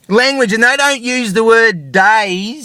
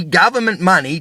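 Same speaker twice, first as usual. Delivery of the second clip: usual speaking voice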